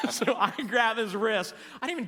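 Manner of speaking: cracking up as you tell it; real laughter bubbling into the story; laughing. Real, not acted. Cracking up at his own trick.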